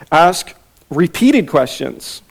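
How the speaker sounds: slow, weighty emphasis on "Ask repeated questions"; slow emphatic. Shown as a demonstration. Teacher announcing point two slowly. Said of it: "number one".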